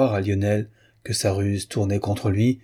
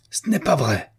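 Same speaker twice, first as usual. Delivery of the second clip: higher, furious denial; cry of rage; 5 semitones higher than usual. A narrator's reading. Jealous lover shouting at the woman, in a rage. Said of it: "Cria Lionel avec rage".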